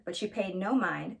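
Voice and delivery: ordinary delivery, nothing marked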